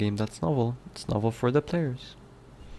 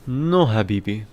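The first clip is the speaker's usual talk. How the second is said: loud, hammed-up Egyptian accent on Habibi; mock Egyptian exclamation, No louder; playful Egyptian No, Habibi; 6 dB louder than usual. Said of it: "Do an Egyptian accent".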